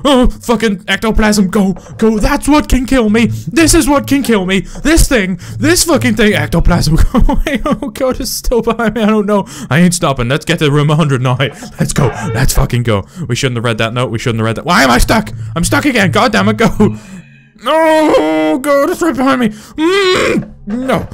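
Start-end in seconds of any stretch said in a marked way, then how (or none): 0.0-9.5 higher, frantic rant on the run; panicked outburst while fleeing. Real, not acted. Fleeing the monster in game. 14.6-21.1 high, wailing panic; panicked. Real, not acted. Stuck again, monster right behind.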